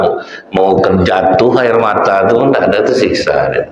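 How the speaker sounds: ordinary talk, nothing marked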